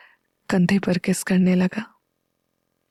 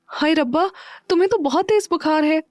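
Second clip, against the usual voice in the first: higher, alarmed voice; worried; 7 semitones higher than usual. A narrator's reading. Meera finds Neel feverish, higher worried voice. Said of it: "Meera परेशान होते हुए बोली".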